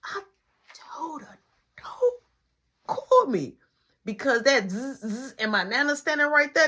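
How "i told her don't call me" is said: halting exasperated complaint. Retold or imitated. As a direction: spell it out in frustration, pausing between words. Exasperated at risky missed call.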